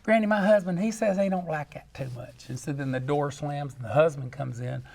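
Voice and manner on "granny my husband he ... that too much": put-on high, sheepish young woman voice; young lady. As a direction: complain sweetly like a country girl. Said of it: "And she says".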